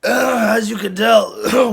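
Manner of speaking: hoarse, strained voice; croaking through a lost voice. Real, not acted. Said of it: "I've lost my voice".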